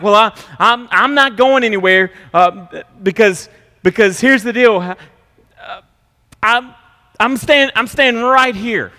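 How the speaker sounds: high, loud, stammering defiance; stubborn spouse. Retold or imitated. Spouse refusing to leave, high loud stubborn defiance. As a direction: plant your feet and refuse. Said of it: "you'll say things like".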